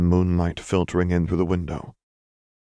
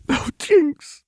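very high, louder tearful wail; sobbing; 5 dB louder than usual. A narrator's reading. Lux answering wounded Jinx, sobbing. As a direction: sob it out in anguish. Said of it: "Lux sobbed".

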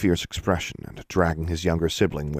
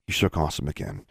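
ordinary delivery

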